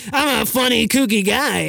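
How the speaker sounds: high, put-on goofy voice; kooky guy. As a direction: do a silly cartoonish character. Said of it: "I do impersonations".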